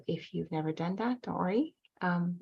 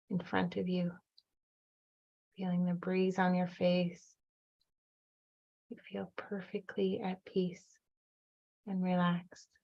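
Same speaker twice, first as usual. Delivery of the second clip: calm, even meditation voice with long pauses; guided meditation. Acted. Guide leading listeners back to their inner child, calm meditation voice. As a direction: guide them gently, leaving room to breathe between phrases.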